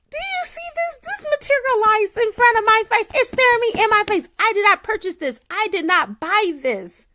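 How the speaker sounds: thrilled disbelief; amazed excitement. Real, not acted. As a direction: gush in wonder at the viewers. Amazed at the manifested deck.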